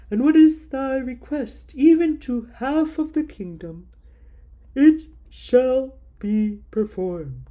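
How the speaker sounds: a king granting favor in a grand proclaiming tone. Acted. King offers Queen Esther half his kingdom, booming and grand.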